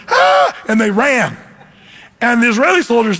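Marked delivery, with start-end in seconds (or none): 0.1-0.5 short, higher-pitched "Ah!"; imitated panicky cry; mock scream of fright. Imitating Philistines fleeing after Goliath's death, mock frightened cry. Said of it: "the Philistines went".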